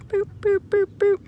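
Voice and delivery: high, evenly spaced boops; mock pecking sound effect; playful booping. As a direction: pop out little high-pitched boops. Imitates beak snapping up bugs.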